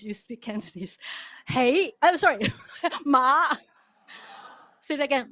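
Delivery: normal talking voice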